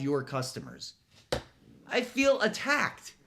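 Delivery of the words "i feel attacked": mock wounded. Mock hurt aside.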